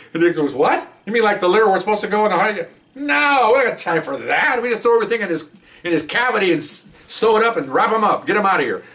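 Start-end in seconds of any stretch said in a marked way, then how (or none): 0.5-2.7 high, quick disbelieving question; incredulous doctor. Retold or imitated. Doctor answering the recruit's naive question, incredulous. 2.9-8.8 high-pitched, emphatic brush-off; dismissive doctor. Retold or imitated. Doctor brushing off the naive recruit, dismissive.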